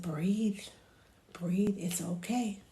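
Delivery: soft, slow coaching; hushed reassurance to a baby; calming. Shown as a demonstration. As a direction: gently guide them to breathe. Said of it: "I tell her, I said".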